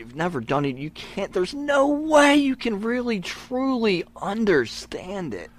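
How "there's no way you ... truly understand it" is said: high, slow stress on "no way you can really"; emphatic insistence. Real, not acted. Wrestler arguing outsiders can't understand, slow emphatic insistence. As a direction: draw out "truly" to drive it home.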